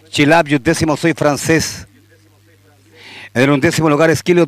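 ordinary talk

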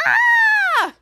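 piercing high loud scream. Retold or imitated. Piper shrieking over the spaghetti, piercing scream. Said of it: "Piper said".

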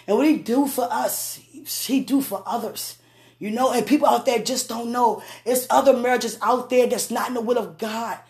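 ordinary talk, nothing marked

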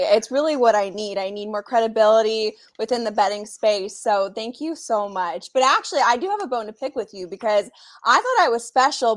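ordinary delivery

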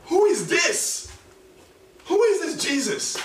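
high, slow, amazed question; astonished. Retold or imitated. Disciples asking in amazement who Jesus is. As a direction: gasp it out in wonder. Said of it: "these guys are like".

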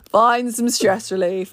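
ordinary delivery

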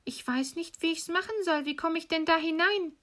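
put-on very high little-girl voice; feigned innocence. Gretel seeing through the witch, feigned innocence. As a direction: play helpless in a high child's voice. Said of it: "Gretel merkte, was sie im Sinn hatte, und sprach".

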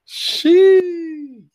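very high, loud, long-held squeal; playful high-pitched squeal; squealed exclamation. Pretend. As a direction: hold it out in a piercing falsetto. Hyped reaction to a flirty quote.

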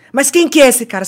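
retold angry demand. Boyfriend demanding to know about a man, angry. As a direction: imitate an angry interrogation.

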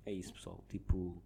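muffled voice under a helmet; inside a helmet. Real, not acted. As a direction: talk with a helmet on. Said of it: "com os óculos e com o capacete". (voz abafada dentro do capacete)

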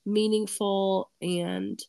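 ordinary talk, nothing marked

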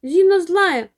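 put-on hurt boy shouting at his sister. A narrator's reading. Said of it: "кричит ей Костя".

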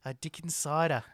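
mimicking the ad man's Australian-like accent; imitated ad accent. Co-host parroting the sponsor's advert, put-on accent. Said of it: "he's got that accent".

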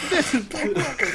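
high, mocking echo of the announcer. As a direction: parrot the intro in a squeaky voice. Echoing the intro voice.

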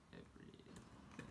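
breathy whispered echo of "Everything"; hushed repetition of "Everything". Shown as a demonstration. Whispered echo of model word.

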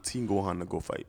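ordinary talk, nothing marked

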